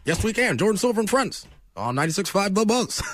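rally-style Obama delivery; Obama impression. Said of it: "I can't ever keep the accent".

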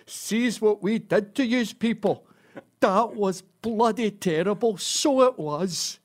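slow, drawn-out weepy Glaswegian; mock-tearful Glasgow apology; tearful Glasgow drunk. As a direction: lament it like a sobbing Glasgow drunk. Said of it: "kissing the top of it and crying".

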